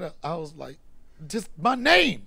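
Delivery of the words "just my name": slow, loud, high-pitched answer; emphatic reply. Retold or imitated. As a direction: stretch it out with comic exasperation. Punchline reply about his Instagram.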